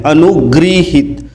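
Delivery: अनुगृहित slowly, ग्री clearly sounded; slow careful pronunciation. Shown as a demonstration. Teacher drilling class, अनुगृहित slow and careful. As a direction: spell out अनुगृहित syllable by syllable. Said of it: "ग में री की मात्रा रहेगी".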